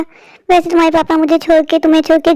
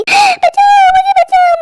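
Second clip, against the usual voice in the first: shrill high panicked scream, much higher; the burning witch screams for help; 13 semitones higher, much slower than usual. Acted. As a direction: shriek for help as the fire catches you.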